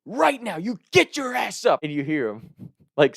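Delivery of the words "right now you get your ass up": mother ordering her son out of bed; mom yelling. Retold or imitated. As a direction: bark it like a fed-up mom.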